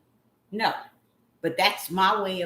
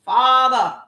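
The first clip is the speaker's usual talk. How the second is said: loud, raised cry; screaming; 4 semitones higher, 9 dB louder than usual. Real, not acted. Crying out to the Father, screaming. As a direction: call out forcefully to God. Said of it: "I am screaming".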